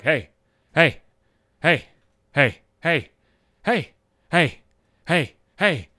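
short, insistent heys with gaps between; pestering repetition. Acted. Person pestering someone like a meowing cat, insistent repetition. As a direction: nag someone with the same evenly repeated call. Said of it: "over and over and over again".

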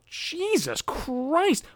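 high, slow, strained exasperation; exasperated. Real, not acted. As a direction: groan it out in disbelief. Exasperated rant about ice puns.

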